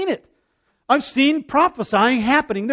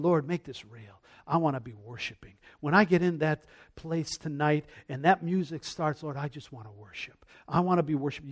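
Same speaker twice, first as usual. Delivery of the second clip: soft, low, devoted murmur; hushed prayer; 6 semitones lower, 10 dB quieter than usual. Retold or imitated. Worshiper praying before the music starts, hushed and earnest. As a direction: pray it inwardly, very quietly.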